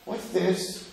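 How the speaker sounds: soft, high, innocent question; simple son. Acted. Simple son asking at the Seder, mild puzzlement. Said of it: "He's not just the Tom".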